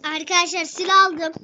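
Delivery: loud, thrilled call to viewers; excited gamer reveal; excited announcement. Real, not acted. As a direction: shout out the new find. Excited at picking up a gun.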